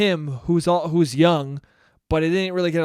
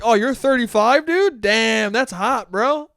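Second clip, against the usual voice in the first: higher and louder, hyped; impressed bro; 6 semitones higher, 6 dB louder, slower than usual. Retold or imitated. Mocking himself meeting an older person, hyped. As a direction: gush like a bro impressed by age. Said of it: "I meet somebody and I'm like".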